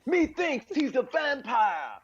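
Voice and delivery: hammed-up stilted Keanu delivery, laughing; high, slow, laughing parody of a wooden actor; mock Keanu line. Comedian mocks Keanu's Dracula line, laughing and hammed-up.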